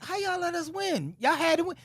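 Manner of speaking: high, soft plaintive complaint; sad disbelief. Retold or imitated. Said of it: "I was kinda sad".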